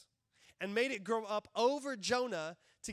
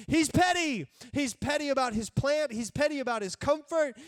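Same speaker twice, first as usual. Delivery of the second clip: louder, higher, punching petty; emphatic petty; 4 semitones higher, 7 dB louder than usual. Real, not acted. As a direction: hammer home the word petty. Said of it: "this dude is petty".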